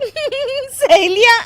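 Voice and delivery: high, slow laughing question; mocking laugh. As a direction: laugh at the very idea. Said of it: "तुम फिर से हंस रही हो".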